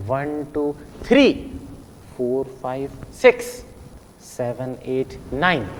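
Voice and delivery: One, टू soft and low, then three punched, likewise six, nine; every third number stressed. Shown as a demonstration. Teacher counting every third one for pupils, stressing three, six, nine. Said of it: "हर तीसरे को गिनना है".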